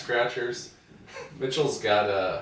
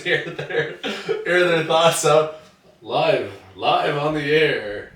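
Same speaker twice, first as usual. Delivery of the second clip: mock radio announcer; 6 semitones higher, 10 dB louder, much slower than usual.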